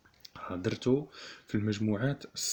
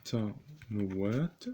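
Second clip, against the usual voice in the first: بوتانوات stretched out slowly; slow dictation; much slower than usual. Shown as a demonstration. Teacher dictating to students, بوتانوات drawn out slowly.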